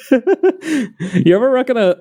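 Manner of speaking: ordinary talk, nothing marked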